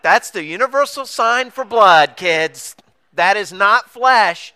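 high, loud insistent lecture; teasing the missionaries, loudly insisting; mock scolding. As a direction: set the kids straight firmly.

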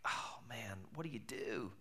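hushed, breathy panic under the breath; stunned whisper in the dark; whispered dismay. Retold or imitated. Panic after losing passport.

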